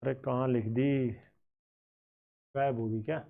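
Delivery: low, soft muttering while hunting for something; puzzled self-talk. Real, not acted. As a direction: wonder quietly to yourself. Searching for missing written page.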